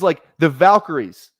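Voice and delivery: ordinary talk, nothing marked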